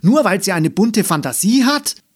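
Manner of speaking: furious disbelieving shout; scolding outburst. A narrator's reading. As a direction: burst out in outrage. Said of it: "schimpfte Mama plötzlich".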